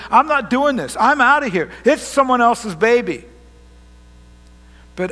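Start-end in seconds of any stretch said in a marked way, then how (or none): 0.1-3.2 acted indignant refusal, loud and high; adamant refusal. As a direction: play a man loudly backing out. Acting out Joseph deciding to leave.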